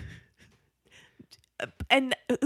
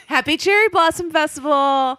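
cheerful call; 6 semitones higher, 6 dB louder than usual. Retold or imitated. Character line called loudly.

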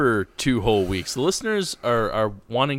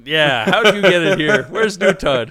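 mock surprise; 4 semitones higher, 6 dB louder than usual. Mock surprise at old Tud.

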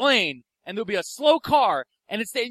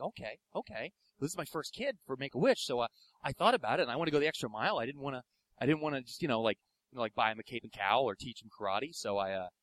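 soft, low halting mumble; softer, lower, hesitant deadpan; McGrail's way of talking; 8 semitones lower, 12 dB quieter, faster than usual. Retold or imitated. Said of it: "that's how he talks".

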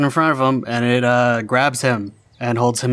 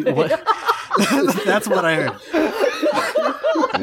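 laughing; 13 semitones higher, much slower than usual. Real, not acted. Laughing at misheard line.

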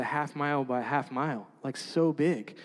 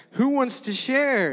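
higher, slow teacher's question; teacher asking class; 9 semitones higher, 5 dB louder, slower than usual. Retold or imitated. Classmates invited to share, mock cheery teacher. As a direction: lightly play the cheerful teacher. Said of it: "they're like".

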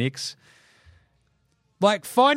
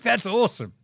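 ordinary talk

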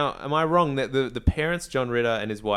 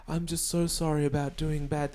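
higher, hammed-up kid apology; playing it up; 5 semitones higher, 3 dB quieter than usual. Bad kid hams up an apology to the orphanage manager, playing it up. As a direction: lay on a mock-sorry kid voice. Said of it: "he's really playing it up".

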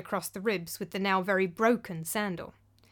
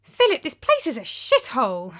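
louder, raised, disgusted outburst; exclaiming; 5 semitones higher, 6 dB louder than usual. Acted. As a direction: blurt out the complaint. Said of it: "I exclaimed".

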